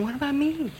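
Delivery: put-on soft, high, childish little complaint; mock whine. Wife teasingly voicing her husband who feels left out, soft whine. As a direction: murmur it like a left-out kid, for a laugh.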